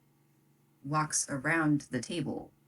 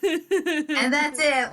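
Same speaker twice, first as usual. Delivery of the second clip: drawn out, higher, through laughter; slow dramatic close; 10 semitones higher, 4 dB louder, much slower than usual. Acted. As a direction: stretch it out as the final line of the game. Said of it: "GM ends the session".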